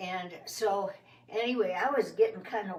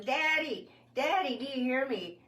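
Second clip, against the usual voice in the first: calling for daddy; 5 semitones higher than usual. Retold or imitated. Stuck child calls for daddy coming home, higher call. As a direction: call out to daddy, hoping he hears. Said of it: "So I was yelling".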